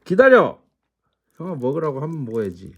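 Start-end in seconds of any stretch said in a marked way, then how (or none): 0.1-0.6 loud command. Real, not acted. Owner commands dog to wait.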